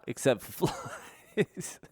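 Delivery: real laugh breaking into the words; breaking into laughter. Real, not acted. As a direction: crack up on the last word. Cracks up retelling the pitch.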